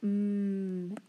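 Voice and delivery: a held hum; m sound. Shown as a demonstration. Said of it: "that long I".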